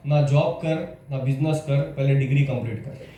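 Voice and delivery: lecturer's advice. Retold or imitated. Retold lecturer's stern advice.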